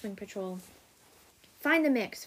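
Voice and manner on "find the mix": a loud, high snapped order; snapping. A narrator's reading. Blizzard snapping orders at the Ice Wing patrol, loud and high. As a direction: snap it at the patrol like a furious commander. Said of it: "Blizzard snap at the patrol".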